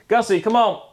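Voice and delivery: high, loud call to a dog. Real, not acted. Vlogger breaks off to call his dog over, high and loud.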